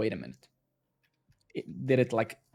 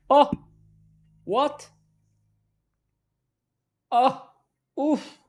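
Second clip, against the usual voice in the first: short, much higher startled cries; surprised yelps; 12 semitones higher, 8 dB louder, slower than usual. Real, not acted. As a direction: caught off guard. Sudden result appears on screen.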